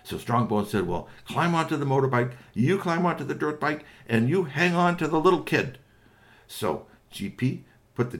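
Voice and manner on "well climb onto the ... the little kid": higher-pitched, insistent character voice; instructing. Acted. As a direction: give firm boarding instructions, raised pitch. Strongbones giving boarding instructions.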